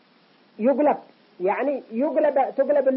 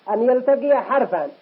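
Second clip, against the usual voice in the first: usual speaking voice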